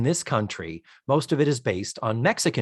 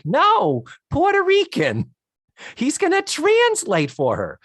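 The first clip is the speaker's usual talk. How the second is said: husband's answer pitched way up, hammed-up; high mock voice; 13 semitones higher than usual. Husband explaining he'll translate, in a high hammed-up voice. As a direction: squeak it out like a sitcom dad.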